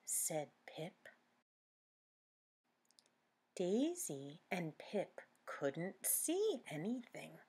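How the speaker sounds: plain reading voice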